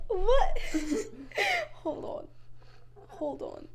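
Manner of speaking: ordinary delivery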